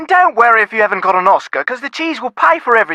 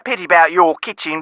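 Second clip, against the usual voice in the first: usual speaking voice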